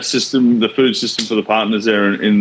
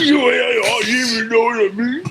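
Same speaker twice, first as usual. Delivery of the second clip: much higher, shouted, mock-frantic voice; mock flustered mate; 13 semitones higher than usual. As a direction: squeal it like your mate losing his cool. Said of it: "He's like".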